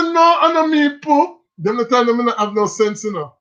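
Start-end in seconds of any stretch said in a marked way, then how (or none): 0.0-1.4 put-on childish denial. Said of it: "Me say".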